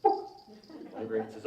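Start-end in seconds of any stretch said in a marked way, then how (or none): 0.0-0.3 short clucked word, like a hen; clucking chicken. Acted. Chicken answers the librarian, clucking. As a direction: cluck it out in one quick peck. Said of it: "The chicken says".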